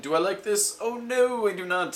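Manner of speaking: high, fussy little fish voice; a worried fish objecting; fretful fish. Acted. The fish protesting to the cat, high and fussy.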